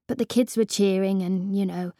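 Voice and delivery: ordinary delivery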